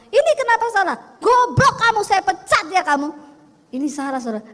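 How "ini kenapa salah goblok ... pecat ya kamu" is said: loud, high furious shout at the staff; angry boss yelling. Retold or imitated. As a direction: yell at the clerk over the mistake. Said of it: "Biasanya dulu kalau ada salah". (bos membentak pegawai dengan marah)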